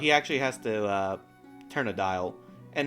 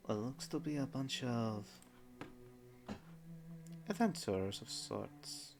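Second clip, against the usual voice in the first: quiet, drowsy goddess just waking up; soft waking goddess; 15 dB quieter than usual. Acted. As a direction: murmur it, half awake and puzzled.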